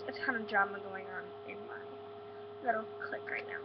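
hushed gossip whisper. Real, not acted. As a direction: whisper the drama like a secret. Gossiping about friend drama.

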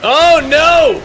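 sharp shocked yell; shout. Real, not acted. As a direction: cry out in alarm. Sudden in-game attack, shout heard.

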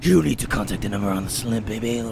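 low, smooth officer voice; low deep inspector voice. Acted. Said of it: "where that deep voice came from".

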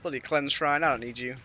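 ordinary talk

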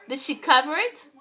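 usual speaking voice

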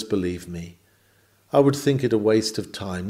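usual speaking voice

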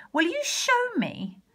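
put-on high, sweet little-girl question; innocent high voice. Gretel feigning innocence to the witch, high and sweet. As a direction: play dumb to trick her.

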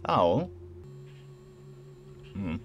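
normal talking voice

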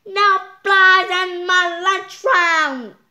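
loud high singsong shout; loud high singsong cry; cried out. Acted. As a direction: screech it like an angry cook. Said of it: "Miss Muzzle cried".

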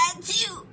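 high, story-time achoo; hammed-up elephant sneeze; acted sneeze. A narrator's reading. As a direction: sneeze the word out. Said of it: "said with his knee".

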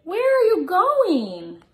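slow, high, playful storybook voice; drawn-out, playful scolding for kids; reading to children. Acted.

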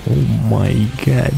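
normal talking voice

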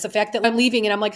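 normal talking voice

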